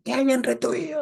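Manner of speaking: high, far-off hammed-up demon roar; mock booming roar. Teacher mimics the demon's huge scream, hammed-up roar. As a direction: ham up a huge demonic bellow. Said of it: "un vozarrón".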